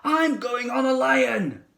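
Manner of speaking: put-on high, slow eager child's voice; excited child cry. A narrator's reading. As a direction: third kid claiming the lion. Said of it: "cried a third one".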